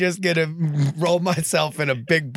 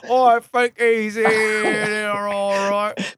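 higher, slow English constable drawl; British constable; 6 semitones higher, much slower than usual. Acted. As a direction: drag it out like a mock British policeman. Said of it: "that's my entire constable".

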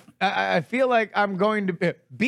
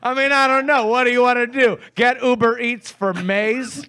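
excited; 5 semitones higher, 5 dB louder than usual. Real, not acted. Bursts louder after rant.

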